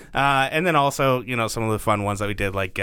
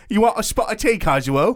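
mock British accent; 9 semitones higher than usual. Co-host offering the guest tea in a mock British accent. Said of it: "That was much better".

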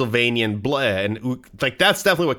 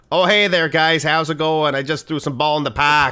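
hammed-up New England local, higher and louder; New England accent; 4 semitones higher, 3 dB louder than usual. Game master voicing a ranger's relatives, exaggerated New England accent. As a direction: greet folks in a broad, chummy Stephen King-country accent. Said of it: "Varno is the Stephen King area".

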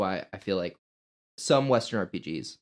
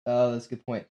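ordinary talk, nothing marked

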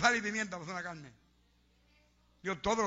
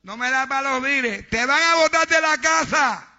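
fiery preacher denouncing tithe robbers; preaching at full force; 4 semitones higher, 10 dB louder than usual. Acted. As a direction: hammer every word home.

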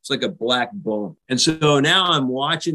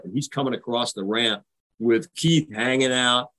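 usual speaking voice

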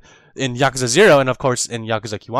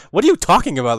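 louder, higher mock disbelief; incredulous; 5 semitones higher, 6 dB louder than usual. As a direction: retell a baffled comeback. Retold incredulous reply to fortune teller.

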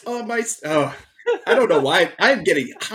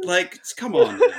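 usual speaking voice

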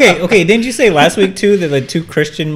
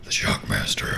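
whispered; 12 dB quieter than usual. Pretend. Wrestling name in a deep whisper.

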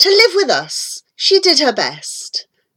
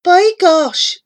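indignant cry; 6 semitones higher than usual. Acted. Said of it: "she cried".

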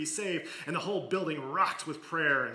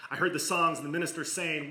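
ordinary talk

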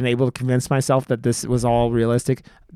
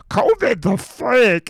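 slow, higher, dopey mock voice of a conspiracy relative; 9 semitones higher, much slower than usual. Mocking a relative who denies covid, slow dopey voice. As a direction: drag it out like a clueless uncle. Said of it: "some relative of yours going".

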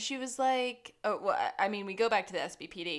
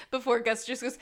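normal talking voice

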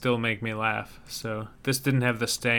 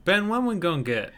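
Southern accent; 6 semitones higher than usual. Shown as a demonstration. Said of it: "this Southern accent segment".

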